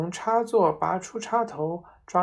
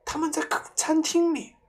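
trembling; 11 semitones higher, 3 dB louder than usual. A narrator's reading. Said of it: "妈妈颤抖着说".

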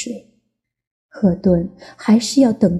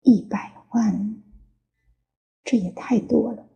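murmuring; 2 semitones higher, 5 dB quieter than usual. Acted. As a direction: murmur it, almost to yourself. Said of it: "喃喃低语".

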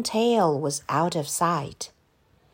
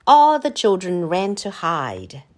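usual speaking voice

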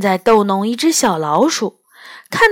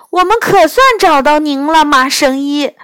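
louder, higher, excited call out; 6 semitones higher, 4 dB louder than usual. Acted. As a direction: shout it with relief. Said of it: "我大声说". (激动地大声喊)

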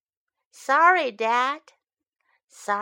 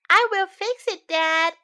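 put-on high, loud little-boy call; child calls to dad about the bee's nest; 8 semitones higher, 5 dB louder than usual. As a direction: call across to dad eagerly. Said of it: "I call".

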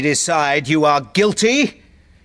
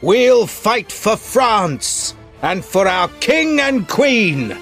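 slow, proud and stirring; rally the friends proudly; rousing pledge; 4 semitones higher, slower than usual. Acted. Athos rallies.

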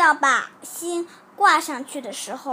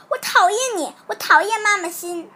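put-on loud, higher-pitched angry child; loud outburst; 6 semitones higher, 7 dB louder than usual. A narrator's reading. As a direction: shout defiantly at the teacher. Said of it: "阿志大声地对老师说". (孩子大声喊出讨厌)

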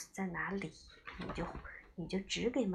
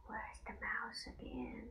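hushed whisper; 4 semitones higher, 9 dB quieter, slower than usual. Acted. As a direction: whisper the question softly. Mother whispering the question.

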